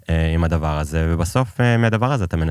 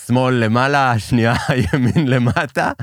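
laughing; 6 semitones higher than usual. Real, not acted. Laughing while telling a punchline.

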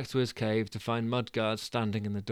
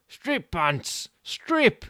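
much higher, loud yell; urgent command; 10 semitones higher, 5 dB louder, much slower than usual. Acted. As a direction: bark the order in panic. Stu orders Bunce.

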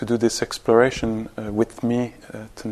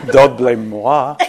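laughing through it, louder and louder; giggling as the joke builds; 3 semitones higher, 7 dB louder than usual. Real, not acted. Teacher laughing at own joke to meditation group. Joking not to blame the author.

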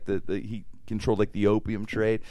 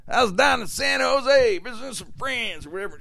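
Calamity Jane; 13 semitones higher than usual. Retold or imitated. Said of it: "She goes".

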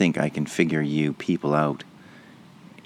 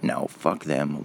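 slow, softer smug dismissal; mocking boomers, slow and softer; muttered mock boomer; 3 semitones lower, 6 dB quieter, slower than usual. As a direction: toss it off quietly like a smug pensioner.

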